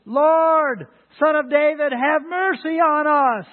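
high, loud, slow cry for mercy; the blind men crying out to Jesus for mercy; shouting plea. A narrator's reading. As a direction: call out to Jesus as he passes by. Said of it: "they shouted".

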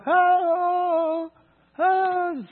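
high, loud far-off call across the lake. Retold or imitated. Woman across the lake calling her lost dog, loud high call.

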